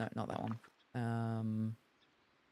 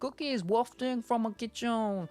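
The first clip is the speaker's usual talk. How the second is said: lightly voiced rat chef, pitched up; chef rat reminiscing; 9 semitones higher than usual. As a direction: share fond food memories as the chef. Voices the rat chef's dialogue.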